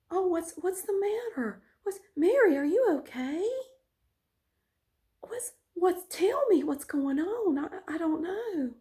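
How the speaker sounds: high, sweet fake concern; innocent angelic act. Teacher feigning innocence to the principal, high and sugary. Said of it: "he gets this little angelic face like".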